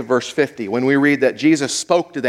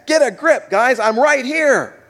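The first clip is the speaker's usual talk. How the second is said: casual reassurance; 9 semitones higher than usual. Retold or imitated. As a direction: reassure the guys, pitched up. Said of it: "what Jesus said to the guys".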